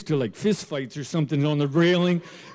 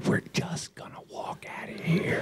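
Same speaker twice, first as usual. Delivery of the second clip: softer whispered repeat; 9 dB quieter than usual. Retold or imitated. Husband whispering to wife to leave, whispered. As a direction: whisper the escape plan. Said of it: "I said".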